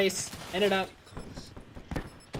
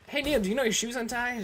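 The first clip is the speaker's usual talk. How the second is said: higher, louder call of a dad jogging beside his son; hey, the dad points out the shoe, a bit raised; 10 semitones higher, 5 dB louder than usual. Retold or imitated. Dad alerts his son mid-race, voice pitched higher and louder.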